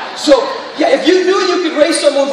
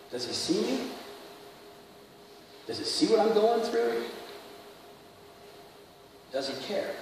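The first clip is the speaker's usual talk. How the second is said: soft, halting questions with long pauses; hushed questioning; 16 dB quieter than usual. Acted. Trauma survivor wondering about God, hushed and hesitant. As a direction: ask it quietly, as someone hurting.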